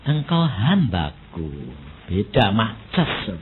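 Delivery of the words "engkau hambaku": slow, low and soft divine voice; voice of God. Retold or imitated. Holy Spirit calling the pastor his servant, slow and soft. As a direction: speak slowly and gently as the Holy Spirit. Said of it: "Roh Kudus ngomong".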